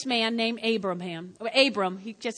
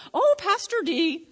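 a lightly voiced pious churchgoer, higher; imitating a churchgoer; 7 semitones higher, 4 dB louder than usual. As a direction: mimic an eager member of the flock. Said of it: "then I get people that do this to me".

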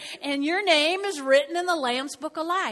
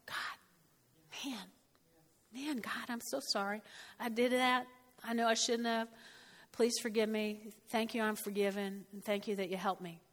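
quiet prayer; 2 semitones lower, 7 dB quieter than usual. Retold or imitated. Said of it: "you know what I do right away?".

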